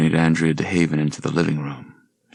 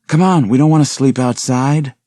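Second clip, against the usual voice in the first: higher, louder hurry-up; urging; 8 semitones higher, 7 dB louder than usual. Acted. Reba pulling Suzanne along, high urging call. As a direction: coax her to keep moving.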